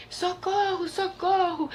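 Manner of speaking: grito agudo de socorro; pajem gritando. A narrator's reading. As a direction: grite socorro bem agudo. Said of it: "gritou o pajem". (pajem pedindo socorro aflito)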